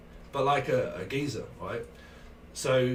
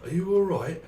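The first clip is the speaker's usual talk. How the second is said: high, loud London challenge; London gangster film voice; 6 semitones higher, 5 dB louder than usual. Acted. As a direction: ask it like a film hard man. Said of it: "It's a bit like Lock, Stock".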